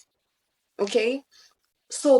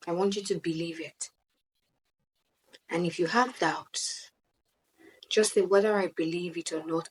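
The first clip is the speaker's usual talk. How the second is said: guided meditation; 5 dB quieter than usual. Acted. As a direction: lull them into stillness. Guided breathing and grounding.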